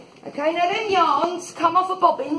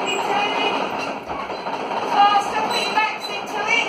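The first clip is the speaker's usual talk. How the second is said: harsh barking commands; 7 semitones higher than usual. Acted. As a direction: bark at the crew to turn faster. Guide drives kids turning rope wheel.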